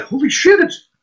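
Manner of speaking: very high-pitched mock panic; high, stunned disbelief, played for laughs; retold freak-out. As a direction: squeak it out as if freaking out. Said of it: "I'm just freaking out".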